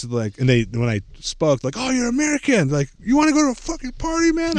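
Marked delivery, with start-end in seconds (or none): 1.8-4.6 much higher, eager dude voice; excited party kid. Retold or imitated. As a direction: pitch the party invite with glee. Kids invite her to party.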